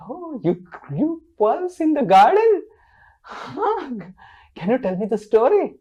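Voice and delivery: high, loud, astonished exclamation; Satyabhama. Acted. Satyabhama asks Krishna for his pearl story, astonished. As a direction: exclaim with swooping, eager wonder. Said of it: "Satyabhama said".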